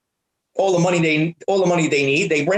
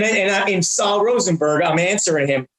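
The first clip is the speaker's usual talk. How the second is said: usual speaking voice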